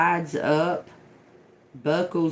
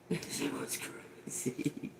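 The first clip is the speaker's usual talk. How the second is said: whispered; 4 semitones higher, 15 dB quieter than usual. Real, not acted. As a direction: drop to a breathy whisper on the repeat. Repeats the line, now whispered.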